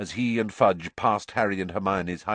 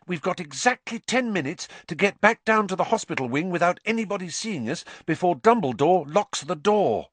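put-on higher, pressing girl's voice; urgent girl's voice; 9 semitones higher than usual. A narrator's reading. Hermione urging Harry back before the door locks. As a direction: urge him on against the clock.

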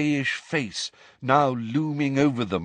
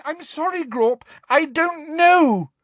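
terrified pleading; 5 dB louder than usual. Acted. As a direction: beg in terror.

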